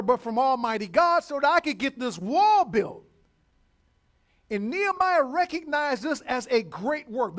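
loud, high-pitched preacher's declamation; full-force preaching. Real, not acted. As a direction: drive it home to the congregation, forcefully.

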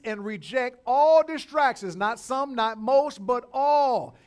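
loud, high, punched preacher's delivery; full-force preaching. Real, not acted. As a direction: hammer each point home to the congregation.

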